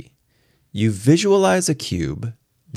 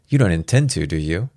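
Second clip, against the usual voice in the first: plain reading voice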